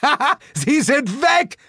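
high, loud gleeful ha-ha; triumphant laughing cry. A narrator's reading. Narrator cheers that the tourists are gone, triumphant. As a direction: crow with delight. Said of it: "rief ich".

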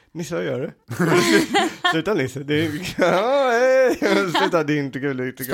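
lightly voiced, laughing scold; mock smiling host. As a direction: playfully scold with a fake smile. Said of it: "smilar och bara".

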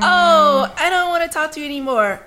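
acted breakup brush-off. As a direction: blurt out a pretend dumping. Voices a breakup text, then blocks.

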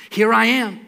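high, loud reply; eager, projected answer to a summons; calling out in answer. Retold or imitated. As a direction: sing out as if running in. Samuel runs in answering the call.

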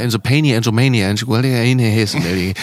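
put-on made-up English, sung like a kid; childish gibberish English lyrics; fantasy English. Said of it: "fast in dem Fantasie-Englisch, wie man so als Kind gesungen hat".

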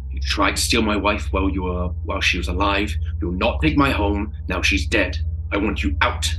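loud, hostile ordering out; a returning infantryman's bitter threat; angry soldier. Acted. Infantryman throws Thomas out of the farmhouse, loud and furious.

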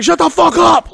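loud, high furious shout; angry shout. Retold or imitated. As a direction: bark it at her in a rage. Retold yell at girl.

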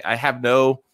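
ordinary talk, nothing marked

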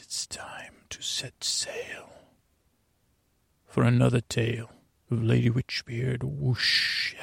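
whispered dramatic show opener; booming kids show opener. Acted. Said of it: "This is our Hollywood announcer".

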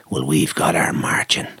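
low, slow whisper; hushed punchline. Retold or imitated. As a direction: whisper it like a secret that lands hard. Quoting the drummer's punchline.

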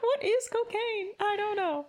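high, clueless voice; ditzy innocent voice; playing dumb.

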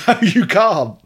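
slow, laughing through the words. Real, not acted. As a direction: say it again while cracking up. Banter about stage antics.

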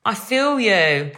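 slow, drawn-out sympathy; touched. Real, not acted. Telling listener Amy she feels for her, slow and touched. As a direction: melt for her a little, slowly. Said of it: "I could have cried with happiness".

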